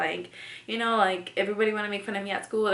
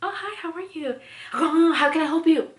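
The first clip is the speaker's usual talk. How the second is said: high, loud sing-song greeting; nail salon worker; 9 semitones higher, 6 dB louder than usual. Retold or imitated. Salon worker greeting a customer, high sing-song chirp. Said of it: "you could go in there like".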